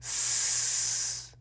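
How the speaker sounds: "s" held as a long hiss; voiceless s. Shown as a demonstration. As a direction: make only the "s" sound, drawn out and voiceless. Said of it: "ends with the voiceless sound".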